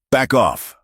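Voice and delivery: high, forceful order with restrained volume; roared command. A narrator's reading. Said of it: "Cal roared".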